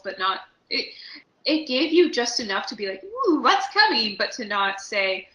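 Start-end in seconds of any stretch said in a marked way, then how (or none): 3.0-4.2 high, drawn-out teased curiosity; mock intrigue. Voicing a viewer teased by the footage, intrigued. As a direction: coo it slowly, loud and high with curiosity.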